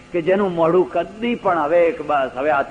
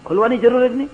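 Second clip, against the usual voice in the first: incredulous echo; 6 semitones higher, faster than usual. Retold or imitated. Astonished echo of host's reply.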